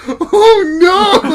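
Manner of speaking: high, loud panicked cry through laughter; mock panicked cry. Friend crashing his parachute, high panicked yell. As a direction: yelp it like you are falling onto rocks.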